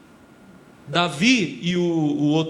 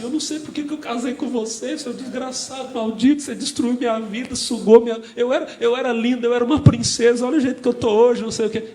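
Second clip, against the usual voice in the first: higher, louder mock furious wife ranting; angry wife; 6 semitones higher, 5 dB louder than usual. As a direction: play a bitter wife cursing her husband. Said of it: "hoje ela tá assim". (imitando uma esposa furiosa, voz mais aguda)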